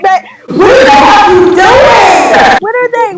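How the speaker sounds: shout. Retold or imitated.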